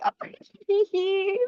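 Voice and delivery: put-on high giggly little-girl squeal; imitating giggling kids. Mimicking little girls giggling at dinner, high and giggly. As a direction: squeal like a happy four-year-old. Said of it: "they're just like".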